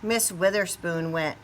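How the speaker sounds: ordinary delivery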